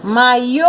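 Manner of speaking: "Maiô" called out, final "ô" rising and held; calling the word. Shown as a demonstration. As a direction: call "Maiô" with a long last syllable. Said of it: "essa eu nem precisava chamar". (chamando "Maiô", com o "ô" final alongado)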